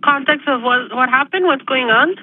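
ordinary delivery, nothing marked

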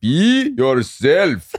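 hammed-up goofy dad, drawn out; dad's weird accent. Repeating dad's catchphrase to the host, slow and silly. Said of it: "he would always just say it in this weird accent".

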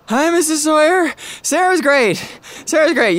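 high, loud, eager repetition; nervously over-the-top cheer; overeager reassurance. Acted. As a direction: sell it too hard to the mom. Friend overeagerly reassuring mom.

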